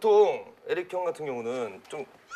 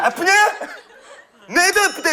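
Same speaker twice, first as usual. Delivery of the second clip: villain catchphrase impression; 11 semitones higher, 6 dB louder than usual. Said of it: "하이로, 하이로".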